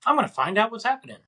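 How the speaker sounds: high, rushed decision; quick, high-pitched self-talk; retold eager resolve. Deciding to go looking for her, high quick resolve.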